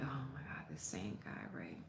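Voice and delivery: hushed whisper of alarm; breathless unvoiced whisper; whispered aside. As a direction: whisper it so he can't hear. Spotting creepy neighbor again, whispered.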